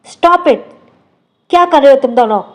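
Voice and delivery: loud sharp shout to stop the fight. A narrator's reading. Father shouting at two fighting girls, loud. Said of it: "जोर से चिल्लाता है".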